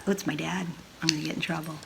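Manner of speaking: soft, low worried thought. Acted. Sarah fearing trouble from dad, soft and low. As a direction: think it quietly in fear.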